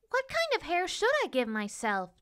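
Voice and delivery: high little paper-doll voice; wondering princess. A narrator's reading. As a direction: muse aloud in a small, high voice. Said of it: "the princess wondered".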